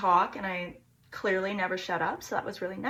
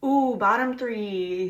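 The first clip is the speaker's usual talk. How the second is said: teasing ooh; 6 dB louder, much slower than usual. Real, not acted. Announcing the worst list.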